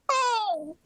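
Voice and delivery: high, glitchy robot yelp. Acted. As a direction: sound like a malfunctioning robot. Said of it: "It was [name] doing a robot voice".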